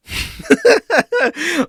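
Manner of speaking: a loud, high cackle of derision; high mocking laugh. Real, not acted. Laughing after mocking the co-host's words. Laughing at his own mockery.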